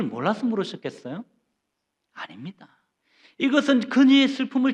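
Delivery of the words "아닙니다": whispered denial for effect; dramatic whisper. Acted. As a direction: breathe the answer in a hush. Preacher's dramatic whispered answer. (속삭이듯 강조)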